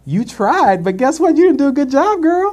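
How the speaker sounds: high, loud and sassy, climbing; sassy put-down. Retold or imitated. Telling the whiner off, high sassy read. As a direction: deliver the read with full attitude. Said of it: "And I was like".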